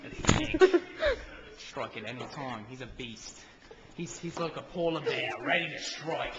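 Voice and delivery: documentary narrator. Acted. Hushed wildlife narration.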